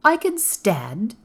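stately and slow; grand. A narrator's reading. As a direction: say it grandly, drawn out. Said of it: "he said it quite grandly".

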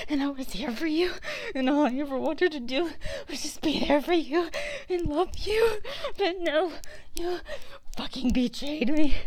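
hurt, angry crying shout; tearful fury. Acted. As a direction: shout the betrayal through tears. Sobbing accusation of betrayal.

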